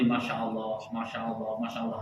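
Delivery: quietly murmured Masya Allah, repeated; hushed repeated praise; soft murmured praise. Shown as a demonstration. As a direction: murmur it under the breath. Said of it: "pujian kepada Allah seperti".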